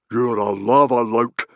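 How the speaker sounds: loud, slow accusing roar; angry roar. A narrator's reading. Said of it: "roared Stoic".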